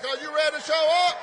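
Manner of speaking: fast and loud, rising on show; rally shout. Real, not acted. A senator calls the rally crowd to show up with a shout. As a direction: fire up the crowd.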